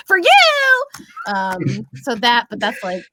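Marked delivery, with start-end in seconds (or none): 0.1-0.8 very high, loud catchphrase voice; exaggerated high-pitched imitation; viral audio impersonation. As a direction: fire it back high and loud, mimicking a viral clip. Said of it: "I'll just instinctually be like".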